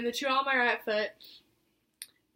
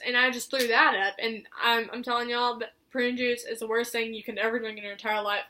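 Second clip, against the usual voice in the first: ordinary talk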